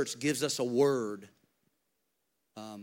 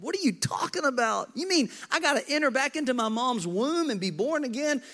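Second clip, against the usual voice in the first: baffled Nicodemus; 10 semitones higher than usual. Acted. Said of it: "Blows Nicodemus's mind".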